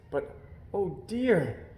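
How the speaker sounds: usual speaking voice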